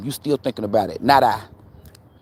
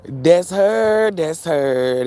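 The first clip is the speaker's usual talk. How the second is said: ancestors' voice; 3 semitones higher, 4 dB louder, much slower than usual. Retold or imitated. Ancestors telling the speaker who gossiped, lightly voiced spirits. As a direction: intone it like spirits pointing her out. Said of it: "God Almighty and the ancestor said".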